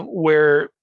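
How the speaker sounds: normal talking voice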